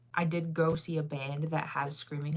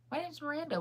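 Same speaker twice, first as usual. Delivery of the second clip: much higher, slightly softer playful repeat; 10 semitones higher, 4 dB quieter, much faster than usual. Pretend. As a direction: pitch it way up, jokingly. Playfully repeats name after laughing.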